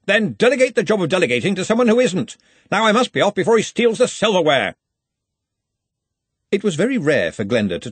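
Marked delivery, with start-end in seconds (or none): 0.1-4.8 high, raised-voice retort; loud bluster on the way out; impatient parting orders. A narrator's reading. Ridcully dismisses Ponder's protest as he leaves, loud and impatient. Boss calls back while leaving.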